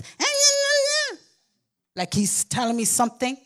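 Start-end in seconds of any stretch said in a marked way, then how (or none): 0.0-1.2 grandson babbling. Retold or imitated. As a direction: drag out a toddler's whine. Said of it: "my grandson is the only one that goes".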